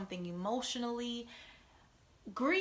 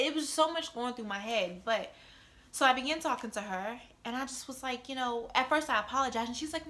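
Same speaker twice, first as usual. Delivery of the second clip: normal talking voice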